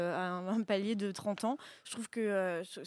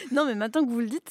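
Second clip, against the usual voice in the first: laughing; 4 semitones higher, 9 dB louder than usual. Real, not acted. Laughing at her own answer.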